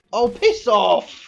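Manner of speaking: high, loud, dragged-out curse; angry outburst. Real, not acted. Streamer cursing a bad draw, loud high slow fury. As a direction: bellow in frustration.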